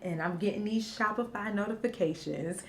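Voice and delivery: soft whisper near tears. Real, not acted. Mom whispers beside her sleeping baby. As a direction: breathe the words out quietly.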